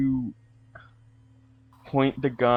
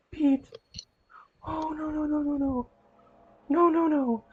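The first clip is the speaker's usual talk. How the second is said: much higher, gasping denial; anguished cry; 14 semitones higher, 3 dB quieter than usual. Acted. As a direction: gasp and plead over a fallen friend. Player character sees friend fall.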